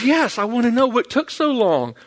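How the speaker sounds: high-pitched imitation of an insistent wife; wife's insistent reply. Wife presses her husband for the reason, high and insistent. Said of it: "She goes".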